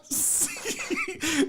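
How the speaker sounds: high, soft giggle; laugh. Real, not acted. Host amused after greeting Sydney, giggling. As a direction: giggle at your own silly accent.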